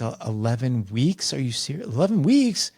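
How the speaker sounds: can't believe the deadline, pitch shooting up; eleven weeks, are you serious, building to a squeak; incredulous disbelief. Retold or imitated. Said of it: "I literally looked at them like that".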